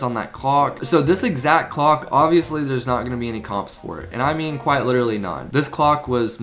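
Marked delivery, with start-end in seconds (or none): none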